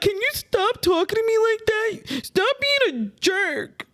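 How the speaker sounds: very high, whiny mock plea; whiny husband. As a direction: mimic the husband whining back. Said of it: "He'd be like".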